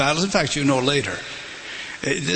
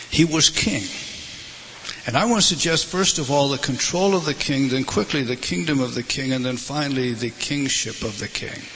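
ordinary delivery

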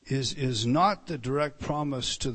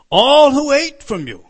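proclaiming; 10 semitones higher, 16 dB louder than usual. Acted. Quoting God with fervour.

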